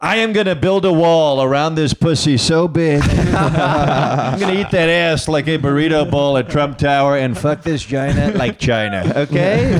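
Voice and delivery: slow, puffed-up Trump bluster; Donald Trump impression. Comedian doing Trump for his date in bed. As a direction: boast it like Trump at a rally. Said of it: "fuck her as Donald Trump".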